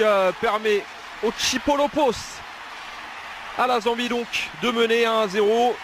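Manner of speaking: rising goal call, pitch climbing; excited sports commentary. Real, not acted. Goal call, live play-by-play.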